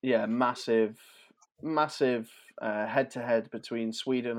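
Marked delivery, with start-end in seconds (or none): none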